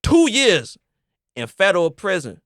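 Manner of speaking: slow, drawn-out dramatic question; game-show question read. Acted. Podcast host posing a dilemma to friends, slow and dramatic. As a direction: tease the hosts with suspense in each pause. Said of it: "return to Ultimate Ultimatums".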